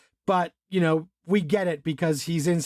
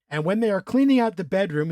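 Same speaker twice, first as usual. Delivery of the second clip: ordinary talk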